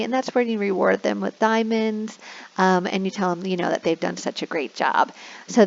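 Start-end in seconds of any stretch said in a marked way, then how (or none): none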